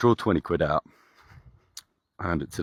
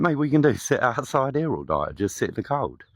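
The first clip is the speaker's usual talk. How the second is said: high, louder mock insistence; insisting; 8 semitones higher, 4 dB louder than usual. Urging the homeless man to take the lift, raised insistence. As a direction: push him to accept.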